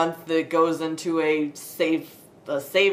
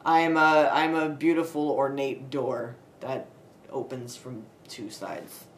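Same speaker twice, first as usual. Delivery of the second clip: ordinary talk, nothing marked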